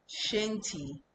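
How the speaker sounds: ordinary talk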